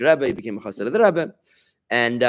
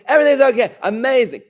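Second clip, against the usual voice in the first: high, loud, chirpy forced-cheerful answer; mock-bright Pollyanna reply; 11 semitones higher, 8 dB louder than usual. Shown as a demonstration. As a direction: gush like nothing could ever be wrong. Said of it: "You don't have to be a Pollyanna".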